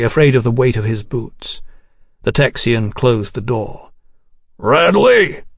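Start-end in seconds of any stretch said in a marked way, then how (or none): 4.6-5.4 put-on drunken shout. Drunk general calls out again.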